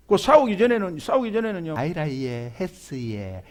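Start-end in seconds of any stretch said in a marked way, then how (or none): 1.8-3.4 soft, low, coy Busan dialect; sweet Busan dialect. Retold or imitated. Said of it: "이렇게 변합니다". (다정하게 말하는 부산 사투리)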